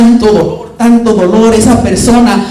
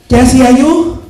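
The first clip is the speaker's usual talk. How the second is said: normal talking voice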